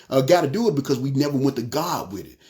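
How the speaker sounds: ordinary talk, nothing marked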